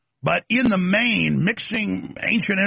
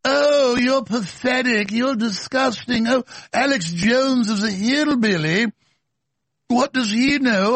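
higher, slow, sneering mock imam voice; 6 semitones higher, slower than usual. Host parodying the imam insulting Alex Jones, slow and sneering. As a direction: drag out each insult like a snooty cleric. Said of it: "here he is with his imam".

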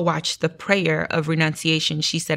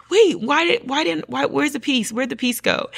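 higher-pitched, stumbling confusion; bewildered; 9 semitones higher than usual. Retold or imitated. Asking where the peace suddenly went, bewildered. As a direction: act out puzzled alarm, pitched up. Retold panic as peace vanishes.

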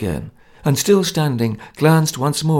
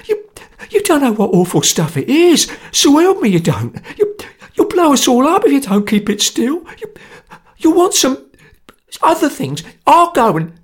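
high, loud, stammering panic; sweat of terror; 14 semitones higher, 5 dB louder than usual. A narrator's reading. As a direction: plead shrilly, tripping over the words in fear. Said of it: "cried Sotcher in a sweat of terror".

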